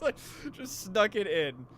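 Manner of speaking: ordinary talk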